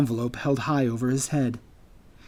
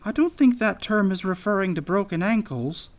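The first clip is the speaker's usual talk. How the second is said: high, doubtful anteater voice; uncertain; 6 semitones higher than usual. A narrator's reading. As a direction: object hesitantly, pitched up. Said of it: "the anteater said uncertainly".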